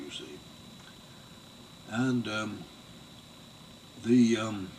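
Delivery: normal talking voice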